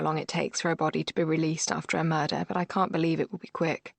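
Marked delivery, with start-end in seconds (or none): none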